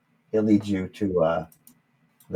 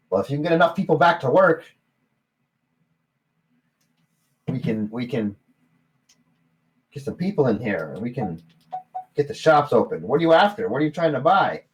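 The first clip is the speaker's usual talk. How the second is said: high, loud, fast gnome; gnome barkeep; 5 semitones higher, 5 dB louder than usual. Acted. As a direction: explain briskly then ask. Gnome explains.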